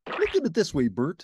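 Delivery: high, bright puppet voice for Ernie. A narrator's reading. Ernie teasing Bert, high cheerful puppet voice. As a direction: play the giddy Ernie.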